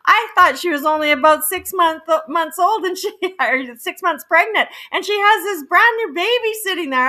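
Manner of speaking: high, loud amazed retelling; shocked. Retold or imitated. Realtor finding pregnant client nursing a baby, shocked. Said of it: "I was just, like, so shocked".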